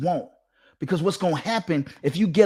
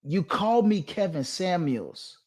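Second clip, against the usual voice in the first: normal talking voice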